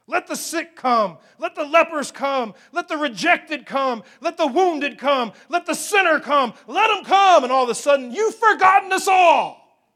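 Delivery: building chant into a full shout; rallying crescendo. Acted. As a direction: punch each plea harder until it bursts into a yell. Preaching crescendo of disciples' resentful cry.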